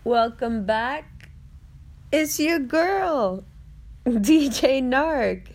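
high, slow, hyped-up sign-on; vlogger intro voice. Acted. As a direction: greet the viewers big and bright.

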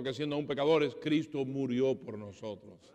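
ordinary talk, nothing marked

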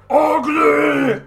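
loud, high brute roar; monster yell. Acted. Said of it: "as he yells out".

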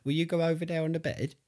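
sing-song command to a dog; high dog-talk voice. Real, not acted. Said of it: "Is this how you talk to the dogs?".

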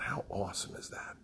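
soft whispered wonder; whispered thought. Retold or imitated. Thinking to himself as the crowd arrives, whispered. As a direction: whisper it to yourself in awe. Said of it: "And I thought".

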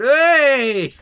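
a loud, high whoop of a cheer; mock crowd cheer. Comic tells a crowd cheering to Class A drugs, mock loud whoop. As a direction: whoop it out like a rowdy punter. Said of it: "and about four people went".

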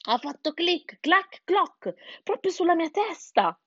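high-pitched little chick; chick retelling. A narrator's reading. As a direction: report each click and clack in a high voice. (vocina acuta di pulcino)

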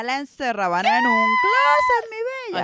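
loud, high, drawn-out outburst; emphatic exclamation. Real, not acted. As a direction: cry it out slow and high. Exclaims over childhood punishment.

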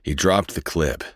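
ordinary delivery, nothing marked

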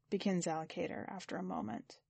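ordinary delivery

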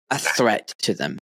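ordinary delivery

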